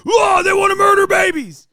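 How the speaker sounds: high, loud, dopey parroting voice; mocking dumb Republican. Streamer mocking Republican followers in a shouted dopey voice.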